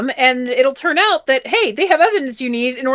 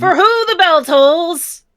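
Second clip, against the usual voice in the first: dramatic voice; 7 semitones higher, 5 dB louder, slower than usual. Pretend. Dramatic slow quotation.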